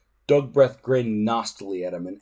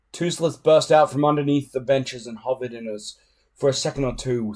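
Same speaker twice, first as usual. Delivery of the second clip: ordinary delivery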